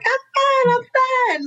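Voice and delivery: forced chipper denial while falling apart; falsely cheery "fine". Retold or imitated. Mother on the floor insisting to friend she's fine, squeaky high denial.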